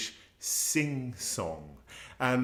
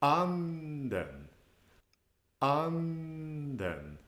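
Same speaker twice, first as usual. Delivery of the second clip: "Anden" held high, then falling; acute pitch accent; 4 semitones higher, 3 dB quieter, much slower than usual. Shown as a demonstration. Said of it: "an acute accent in Swedish".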